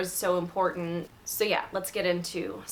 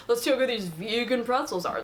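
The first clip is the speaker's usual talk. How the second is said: higher put-on voice mimicking a doubter; mock skeptical; 4 semitones higher than usual. Guest offered a regular snack, mock skeptical. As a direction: parody someone dubious about a snack.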